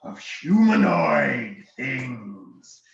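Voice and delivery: slow, loud dragon growl; evil dragon. Acted. Dragon sniffing out intruders, evil dragon voice. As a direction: relish each word like an evil dragon. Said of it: "That's a nice evil dragon".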